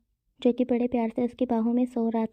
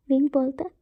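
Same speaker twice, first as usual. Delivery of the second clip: ordinary delivery, nothing marked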